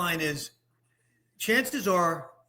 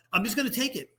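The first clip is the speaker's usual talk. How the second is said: high, nervous resolve; anxious decision; 7 semitones higher, faster than usual. Pretend. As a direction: talk yourself into it, worried. Acting out a worried patient.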